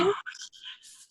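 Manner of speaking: breathy whispered yes. Real, not acted. Answering the host's question, a soft whisper. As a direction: breathe the agreement out softly.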